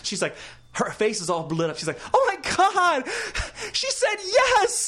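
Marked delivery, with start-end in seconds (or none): 2.1-4.9 high, loud, stretched-out squeal of joy; squealing excitement. Retold or imitated. Agent bursting in with thrilled news, high squeal. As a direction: shriek the good news, drawing out yes. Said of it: "Like that".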